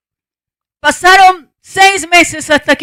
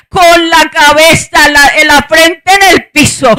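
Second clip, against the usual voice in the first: raised, emotional pulpit voice; impassioned preaching; 6 dB louder than usual. Real, not acted. Mother tells the congregation of praying at dawn, impassioned preaching. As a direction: preach it urgently, building to a cry to God.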